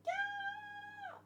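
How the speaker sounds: very high, squealed yeah; excited cheer. Real, not acted. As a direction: squeal with delight. Cheering at the cover.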